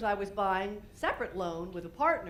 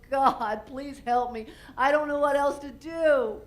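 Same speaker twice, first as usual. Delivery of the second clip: desperate prayer; 3 semitones higher, 6 dB louder, slower than usual. Retold or imitated. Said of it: "and I go".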